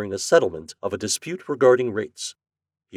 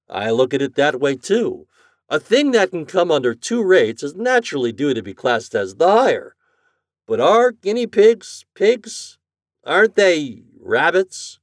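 raised, emphatic reasoning voice; officious department head; 7 semitones higher, 7 dB louder than usual. A narrator's reading. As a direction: argue the point, then question it slowly. Morgan argues with the president.

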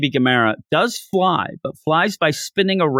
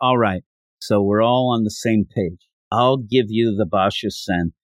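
ordinary talk

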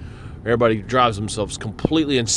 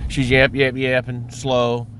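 usual speaking voice

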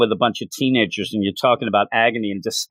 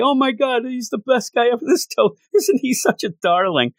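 put-on high-pitched swooning teen, voice raised much higher with a giggle; gushing girl; 10 semitones higher than usual. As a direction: gush over him like a starstruck teenager. Shaylee gushing over Toth.

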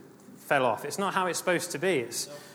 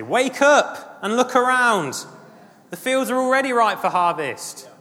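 high, loud drawn-out call; rousing shout; 10 semitones higher, 9 dB louder than usual. Acted. Preacher voicing scripture's call to look around, rousing shout. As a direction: cry it out to wake everyone up. Calls out scripture's wake-up cry.

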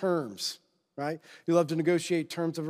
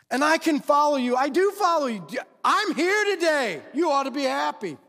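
higher, louder whiny haggler; whiny bargaining believer; 6 semitones higher, 3 dB louder than usual. Retold or imitated. Preacher voices a believer haggling over discipleship, whiny and high. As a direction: plead your case to God like a spoiled haggler.

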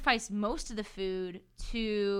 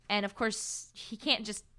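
normal talking voice

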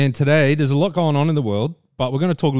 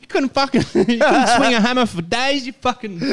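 high, abusive tradie boss; work voice; 9 semitones higher than usual. Acted. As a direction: laugh through a mock site-boss tirade. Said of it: "do us your work voice".

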